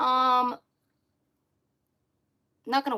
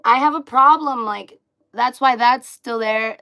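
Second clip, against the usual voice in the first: loud; 4 semitones higher, 13 dB louder than usual. Real, not acted. Loud confession about skin.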